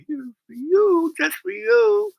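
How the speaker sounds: put-on dog baby talk. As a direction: sweetly tempt the dog with a biscuit. Offering a dog a treat.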